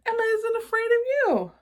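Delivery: put-on child teasing the monster. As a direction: tease him in a high kid voice.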